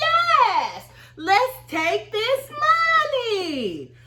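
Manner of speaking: high, slow and eager; eager yes. Retold or imitated. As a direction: grab the offer, drawn out high. Eager yes to the severance money.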